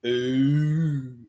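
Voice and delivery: a long drawn-out "Ooh"; "Ooh" as one stretched Wisconsin vowel; imitating held O's. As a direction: hold the O of "Ooh" far too long. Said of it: "she will just hold her O's".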